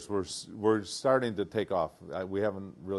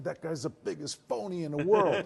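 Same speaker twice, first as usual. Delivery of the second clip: much higher, outraged father voice with a laugh; upset dad; 9 semitones higher than usual. Retold or imitated. The father ranting about the coach, laughing outrage. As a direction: rant like a fuming dad. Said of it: "Then he really got upset".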